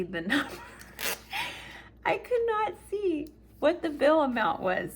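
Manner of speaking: high, laughing disbelief. Real, not acted. Telling viewers about the unreadable bill, laughing. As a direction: break into a laugh while telling it.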